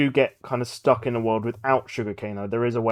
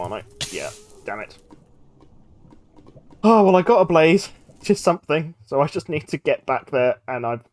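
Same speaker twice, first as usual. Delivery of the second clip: normal talking voice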